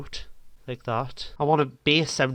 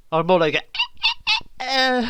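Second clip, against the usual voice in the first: mock crying; 12 semitones higher, 6 dB louder than usual. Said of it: "Some crying there".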